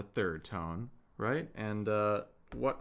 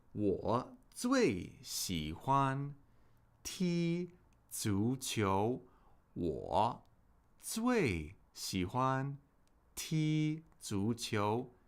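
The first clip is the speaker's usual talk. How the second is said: slow, separated syllables with lengthened tones; very slow model sentence; much slower than usual. Shown as a demonstration. Teacher models the answer for learners, very slowly. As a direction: draw out each word, pausing between phrases.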